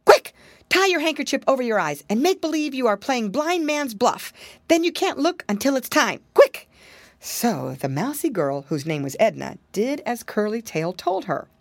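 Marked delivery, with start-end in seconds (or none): 0.0-6.2 higher, hurried little pig; piggy boy rushing the mouse girl; urgent piggy boy. Acted. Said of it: "but what he said was". 6.3-6.7 loud sharp hurry-up; piggy boy urging haste loudly; urgent shout. Acted. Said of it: "but what he said was".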